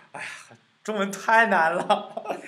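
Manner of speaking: amused, loud exasperation breaking into laughs; laughing exasperation. Real, not acted. Teacher laughing over a Chinese tongue twister, laughing exasperation.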